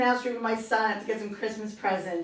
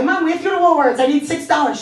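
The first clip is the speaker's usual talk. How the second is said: put-on higher, louder child's request; kid pleading to mom; 5 semitones higher, 7 dB louder than usual. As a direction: call out to Mom for money. Child asking mother for money.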